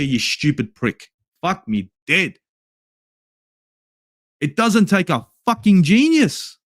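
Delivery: usual speaking voice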